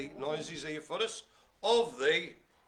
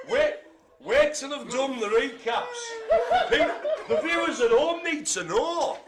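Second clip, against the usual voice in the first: high, raised voice through laughter; loud laughing protest; 10 semitones higher, 7 dB louder, slower than usual. Real, not acted. Host stopping the room jumping ahead of recaps, laughing loud protest. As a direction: plead loudly over the giggles.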